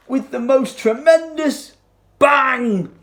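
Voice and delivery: loud, high climactic burst; dramatic bang. A narrator's reading. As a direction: land the big crash loudly. Crocodile crashes into the sun.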